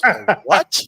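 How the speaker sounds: high, loud "A what?"; retold consultant's incredulous question; mock disbelief. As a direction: act baffled. Consultant baffled by milk fridge.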